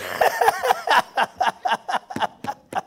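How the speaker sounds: long, real high-pitched laugh; helpless laughter. Real, not acted. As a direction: crack up at your own joke. Laughing at own joke.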